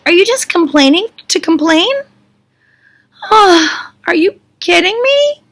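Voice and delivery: high-pitched, hammed-up disbelief; incredulous exasperation. Leader answering people who complain about food, exasperated. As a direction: protest as if you cannot believe your ears. Acts Moshe's outraged reply to complainers.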